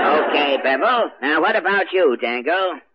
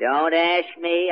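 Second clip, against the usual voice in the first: ordinary talk, nothing marked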